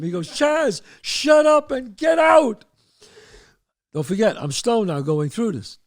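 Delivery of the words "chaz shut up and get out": high, drawn-out yell; angry father. Retold or imitated. Father silences son's friend, high slow angry yell. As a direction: cut him off and order him out.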